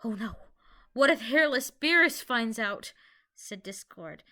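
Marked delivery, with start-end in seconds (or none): 0.0-2.9 mild louder worried panic; a touch of fretting alarm; panicking. A narrator's reading. Discord fearing Beerus finds out, mild panic. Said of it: "Discord then started panicking".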